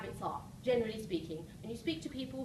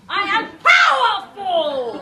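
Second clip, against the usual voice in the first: slow, high, huge shout; booming motivational speech; 7 semitones higher, 19 dB louder, much slower than usual. Shown as a demonstration. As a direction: give it everything you've got. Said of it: "I'm sure you can do better".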